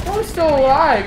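high, loud burst of surprise; startled. Real, not acted. Gamer spotting an enemy still at the spawn, startled. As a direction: exclaim it in disbelief.

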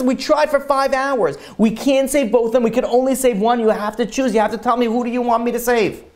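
high-pitched, pressing ultimatum; acted urgent insistence. Doctor forces husband to choose one, urgently insistent.

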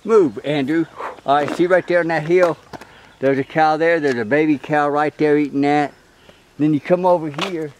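loud, carrying voice across the field; calling out. Real, not acted. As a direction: call it out so they hear. Pointing out distant cows loudly.

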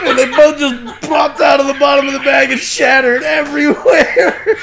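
loud, high, laughing delivery; breaking into laughter at the end; laughing climax. Real, not acted. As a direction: shout the punchline while cracking up. Climax of funny story, laughing.

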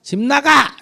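high, loud runaway threat; teen threatening yell. Retold or imitated. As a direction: shout it like a teen storming off. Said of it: "그때 뭐".

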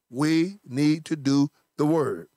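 slow, hammered-home exhortation; emphatic preaching. Real, not acted. Preacher urging church folk to obey, slow and emphatic. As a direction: drive each word home slowly.

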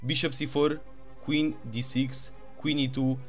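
ordinary talk, nothing marked

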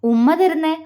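plain reading voice

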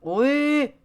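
sudden high loud yelp; high startled shout. Real, not acted. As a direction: yelp out in surprise. Sudden yelp during climb.

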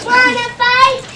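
loud, high, picking a fight; grouchy ladybug. Acted. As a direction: shout it out like a grouchy bug. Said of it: "said the grouchy ladybug".

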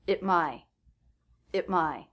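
"it my" with a schwa and a stopped T; reduced at, stop T. Shown as a demonstration. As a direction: cut off the T before "my". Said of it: "so the T here is a stop T".

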